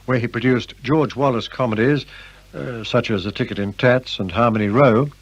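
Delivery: normal talking voice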